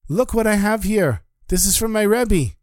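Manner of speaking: high, eager call to Carl; the chassid runs up to show Carl the papers, thrilled; excited. Acted. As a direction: rush over bursting with news. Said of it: "the chassid is so excited".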